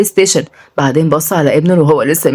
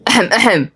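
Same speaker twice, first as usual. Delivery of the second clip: attention-seeking ahem; showy little double cough; mock throat-clearing; 4 semitones higher than usual. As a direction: clear the throat before a pretend speech. Mock throat-clearing before a speech.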